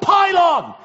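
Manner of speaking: high, loud rallying yell; playground shout. Retold or imitated. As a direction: shout it like a kid calling everyone over. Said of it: "would shout".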